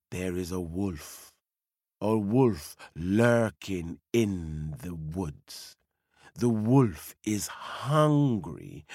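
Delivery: low, slow and hushed for the lurking wolf; ominous storyteller. A narrator's reading. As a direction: make the children feel the danger.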